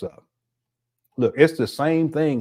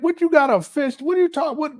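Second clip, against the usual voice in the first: much higher, disbelieving squeal; can't believe what he's hearing; incredulous; 12 semitones higher than usual. Real, not acted. Reacting to the clip in high disbelief. Incredulous at the desk wish list.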